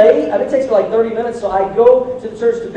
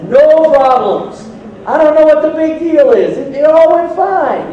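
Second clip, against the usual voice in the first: higher, louder, no problems here; breezy fake nonchalance; 7 semitones higher, 5 dB louder than usual. Husband fibbing to his wife, breezy and carefree. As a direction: brush it off like nothing happened. Husbands plan to tell wives.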